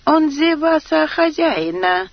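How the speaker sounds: put-on high, unruffled Chinese-accented voice; calm with thick Chinese accent; Chinese accent Russian. A narrator's reading. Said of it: "невозмутимо проговорил китаец".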